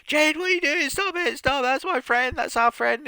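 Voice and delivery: very high-pitched panicked pleading; hammed-up desperate cries to a friend; imitated freak-out. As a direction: plead in a mock frantic squeal. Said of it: "Bianca's absolutely freaking out".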